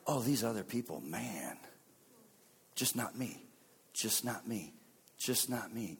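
low, soft private thought; internal muttering. Acted. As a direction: think it aloud under your breath. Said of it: "don't we do that internally?".

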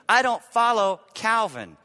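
ordinary talk, nothing marked

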